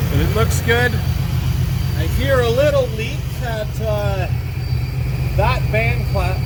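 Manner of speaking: talking loud from under a car; lying under a car. Real, not acted. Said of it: "I'm under the car".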